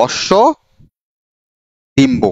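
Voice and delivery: অশ্ব and Dimba said apart with a long gap between; slow word-by-word dictation; split into parts. Shown as a demonstration. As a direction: break অশ্ব Dimba into its two words for dictation. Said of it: "এই শব্দটা হয়ে গেছে অশ্ব ডিম্ব".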